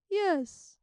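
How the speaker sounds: high, bright yes; eager agreement. A narrator's reading. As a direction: jump at the offer. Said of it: "the dragon agreed".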